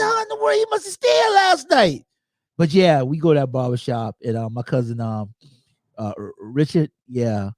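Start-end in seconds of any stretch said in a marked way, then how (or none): 0.0-2.0 much higher, incredulous teasing exclamation; amazed disbelief. Retold or imitated. Speaker finding Keith already at the barbershop, in amazed disbelief. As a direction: marvel that he beat you there. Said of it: "I said".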